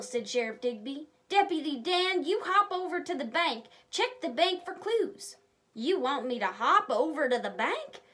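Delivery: lively, expressive storybook narration; reading to children. Acted. A reader telling kids the story of Deputy Dan, lively. Reads story aloud to viewers.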